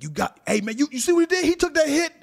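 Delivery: high, loud hype-man coach shouting; 1 hyped coach yelling. Retold or imitated. Coach hyping up his player after a big hit, loud high shouts. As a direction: pump up the team like a yelling coach. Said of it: "your coaches are like".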